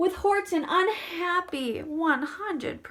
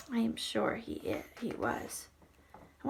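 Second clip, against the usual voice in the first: quiet aside; 5 semitones lower, 11 dB quieter than usual. Real, not acted.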